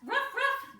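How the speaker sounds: high pretend ruff ruff; yappy mock woof; playful dog bark. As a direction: bark like a puppy for the kids. Acting downward dog.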